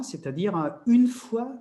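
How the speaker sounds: usual speaking voice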